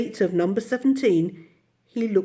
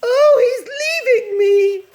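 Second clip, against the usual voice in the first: very high, loud tearful shriek; 9 dB louder than usual. A narrator's reading. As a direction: shriek in despair. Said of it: "shrieked Miss Lark".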